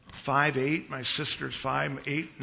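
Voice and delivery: usual speaking voice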